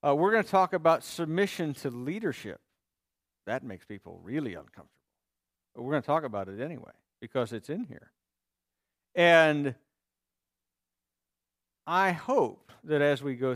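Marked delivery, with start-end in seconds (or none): 3.5-8.1 quick, quiet muttered aside; nervous preacher. Real, not acted. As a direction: rush it under your breath, uneasy. Quick muttered aside about discomfort.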